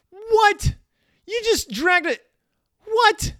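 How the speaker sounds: stunned cubs, very high and loud; shocked cubs. Retold or imitated. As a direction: jump way up into a cartoon cub's shocked squeal. Cubs react in story.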